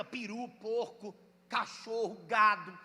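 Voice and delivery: ordinary talk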